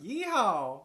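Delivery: cowboy yeehaw. Pretend. Mocking cowboy greeting.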